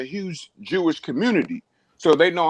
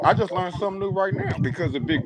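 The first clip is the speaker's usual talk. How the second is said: ordinary talk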